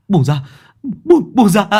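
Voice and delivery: sudden high shout, kicking free; panicked shout. A narrator's reading. Quang shouts at the man holding his leg. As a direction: yell it as if still in the nightmare. Quang kicks waking from nightmare.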